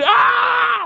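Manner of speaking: high, loud hammed-up scream of pain; retold anguished yell. Friend acting blinded by spray, hammed-up scream. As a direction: scream like you've been blinded.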